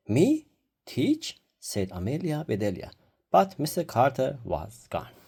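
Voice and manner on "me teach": high, rising astonished question; astonished disbelief. A narrator's reading. Amelia Bedelia startled at being named the teacher, astonished. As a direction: squeak it out in disbelief.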